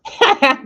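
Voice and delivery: quick high burst of laughter; high giggle. Acted. Laughing at the tenants' confusion, high giggle.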